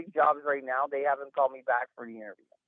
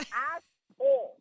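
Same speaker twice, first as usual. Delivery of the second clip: Asshole stretched slow and high; mock-proud Asshole, slowly savoured; drawn-out asshole; 10 semitones higher, much slower than usual. Shown as a demonstration. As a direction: lean on Asshole, drag it out. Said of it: "I am an asshole".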